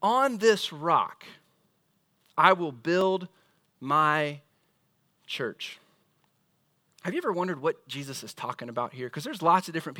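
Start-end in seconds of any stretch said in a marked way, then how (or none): 0.0-5.8 slow On this rock, fading at the end; slow, weighty. Shown as a demonstration. Preacher quotes Jesus to the congregation, slowly. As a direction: let it sink in. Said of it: "But then he says this".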